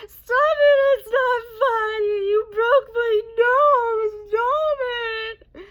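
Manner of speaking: mock wail, very high and loud; hammed-up shrieking sob with long drawn-out words; crying, imitated. Friend with a broken nose protests, imitated loud high wailing. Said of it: "And she's there crying".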